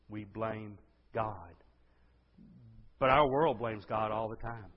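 ordinary talk

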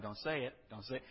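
muttered warning to himself, soft; inner voice. Retold or imitated. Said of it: "it's inside my head".

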